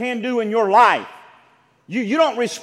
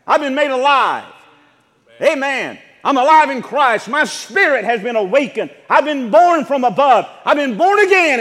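higher and louder, exultant proclamation; rising preaching fervor; 3 semitones higher, 5 dB louder than usual. Real, not acted. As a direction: lift the congregation with joy. Preacher exulting over salvation.

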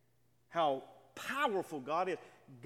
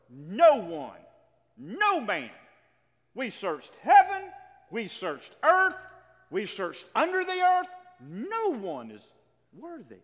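higher and much louder, slowing as the search fails; urgent proclamation; 3 semitones higher, 9 dB louder, slower than usual. Acted. Preacher to congregation, retelling the search for a worthy one: loud, slow, urgent proclamation. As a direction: cry out that heaven and earth were searched, stretching the words.

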